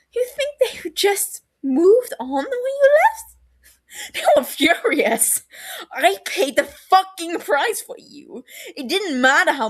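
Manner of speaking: loud, tearful outburst; crying out. Acted. Brother accusing Sirius of leaving him, crying out. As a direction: let the hurt spill over into tears.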